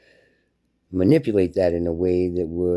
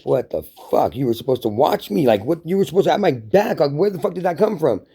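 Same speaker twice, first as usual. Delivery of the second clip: acted indignation climbing in pitch; mock outrage, rising higher; furious; 7 semitones higher than usual. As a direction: demand answers from someone who let you down. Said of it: "when you get up and you're furious".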